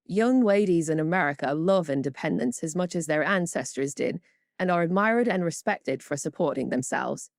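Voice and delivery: ordinary delivery, nothing marked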